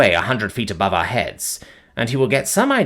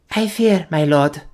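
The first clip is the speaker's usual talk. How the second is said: timid; 6 semitones higher than usual. Acted. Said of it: "the girl answered timidly".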